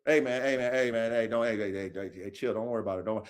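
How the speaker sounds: very low, hushed soothing murmur; acted low, soft reassurance; calming them down. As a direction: talk a hothead down, soft and low. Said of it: "I gotta calm my motherfuckers down".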